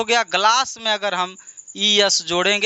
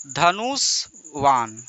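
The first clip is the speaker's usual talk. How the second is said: slow repeat; 7 semitones lower, much slower than usual. Shown as a demonstration. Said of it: "teacher repeats slowly".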